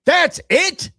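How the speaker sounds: sudden high yell at full volume. Retold or imitated. Buddy Fred yelling out in the movie theater, loud high shout. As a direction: blurt it out like a heckler in the theater. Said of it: "Freddie just yelled out right at the very end".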